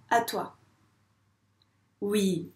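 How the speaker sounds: usual speaking voice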